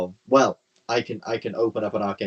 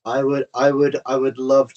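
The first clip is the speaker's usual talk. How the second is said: eager; 6 semitones higher, 10 dB louder than usual. Real, not acted. Player eagerly wants combat.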